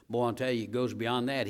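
ordinary talk

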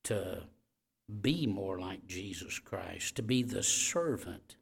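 hushed pastoral prayer; closing prayer. Real, not acted. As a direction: pray it gently over the room.

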